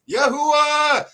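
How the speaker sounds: high, loud holler across a distance; shouted call. Retold or imitated. Brother joining in from across the airport, shouted. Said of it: "he stood up and was".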